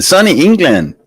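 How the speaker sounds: playful disbelief, jumping up high; mock surprise. Real, not acted. Host teasing a viewer about English weather, playful surprise.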